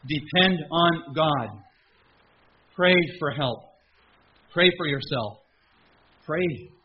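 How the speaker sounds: loud, high preaching to the congregation; full-force preaching. Acted. Pastor exhorting his folks, loud preaching. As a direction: drive the point home to the pews.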